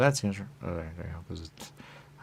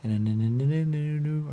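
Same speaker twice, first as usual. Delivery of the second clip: excited; 9 semitones higher, 9 dB louder than usual. Real, not acted. Rising excited repetition.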